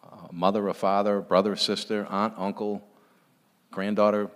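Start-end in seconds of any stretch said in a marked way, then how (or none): none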